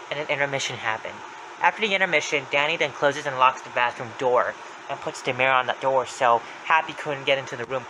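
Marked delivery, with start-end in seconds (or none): none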